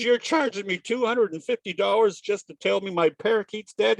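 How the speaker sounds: high-pitched, indignant protest; mock outrage. Owner protests vet's bill, hammed-up high outrage. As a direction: act out an incredulous customer, pitch well up.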